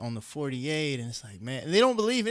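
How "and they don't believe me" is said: excited burst. Real, not acted. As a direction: pitch jumps up high, louder, full of excitement. Excited telling about heroes.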